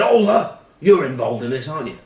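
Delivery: high, loud accusation; accusing shout. Retold or imitated. Posh teammate confronting Oliver, high angry accusation. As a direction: point the finger and demand an answer.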